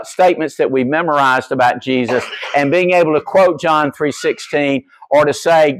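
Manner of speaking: ordinary talk, nothing marked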